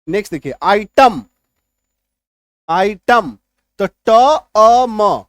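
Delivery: आइटम said loud with a short "टम" ending; a loud आइटम, then the sounds ट, अ, म taken apart; pronunciation demo. Shown as a demonstration. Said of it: "अ छुपा हुआ है". (आइटम को ज़ोर से, "टम" को अलग करके)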